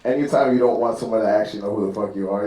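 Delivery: ordinary talk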